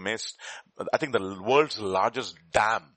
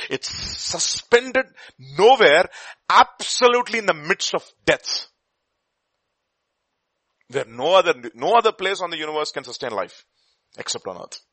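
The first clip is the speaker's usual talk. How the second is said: awed astronaut quote; 4 semitones higher, 7 dB louder than usual. Retold or imitated. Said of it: "and he says".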